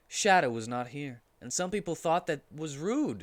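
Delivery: ordinary delivery, nothing marked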